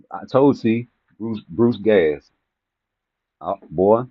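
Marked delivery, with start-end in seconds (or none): none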